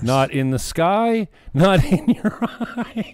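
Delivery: slow, high teasing chant; mocking sing-song. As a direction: tease him in a singsong. Mocking the tearless crying clip.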